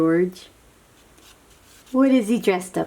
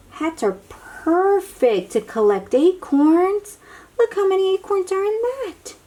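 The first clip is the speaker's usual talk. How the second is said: much higher, amazed; delighted wonder; 11 semitones higher than usual. Real, not acted. Showing children the acorn-filled hats, delighted. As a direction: marvel at it brightly.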